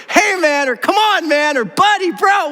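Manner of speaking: high-pitched, fed-up appeals; exasperated protest. Acted. Preacher paraphrasing how Jesus says "man", exasperated. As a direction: plead with a pal in mock exasperation.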